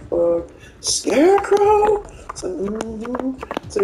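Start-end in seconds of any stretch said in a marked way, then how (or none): 0.1-2.0 high, very slow drawn-out curse; mock exasperated opponent. Opponent curses Scarecrow, high and very slow. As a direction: drag out the name in disbelief. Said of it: "He said".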